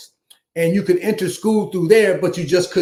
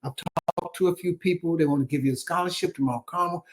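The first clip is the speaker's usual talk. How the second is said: softer, gentle reassurance from Mom; soft mother's voice; 8 dB quieter than usual. Retold or imitated. Mother calmly tells her son about the scholarship, soft and reassuring. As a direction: ease him in quietly like a loving mother.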